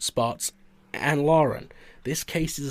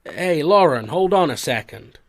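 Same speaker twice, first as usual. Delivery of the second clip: calling out; 4 semitones higher, 7 dB louder than usual. Acted. Russell calling after Lauren.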